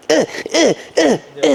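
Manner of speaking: short high-pitched effort grunts; imitated kid's grunts. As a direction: mimic a small child swatting at a hand. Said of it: "They'll be like".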